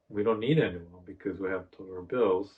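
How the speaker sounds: ordinary talk, nothing marked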